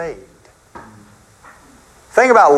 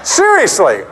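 ordinary delivery

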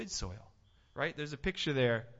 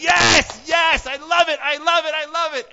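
much higher and louder gushing; fired-up churchgoer; 12 semitones higher, 17 dB louder than usual. Pretend. Preacher mimics an excited churchgoer, loud and high. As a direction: gush like you're on a Sunday high.

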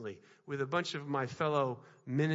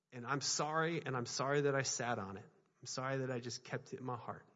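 softer, low, earnest apology; quiet sincere apology; 5 semitones lower, 6 dB quieter than usual. Retold or imitated. Pastor retelling his apology to a brother, soft and sincere. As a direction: confess it gently, from the heart.

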